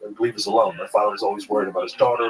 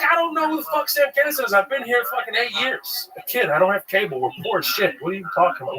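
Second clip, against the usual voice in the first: much higher, hammed-up indignant ranting; mock exasperated rant; 13 semitones higher than usual. Young comic snapping back at the open micer, mock rant. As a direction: rant like a fed-up kid. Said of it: "I was like".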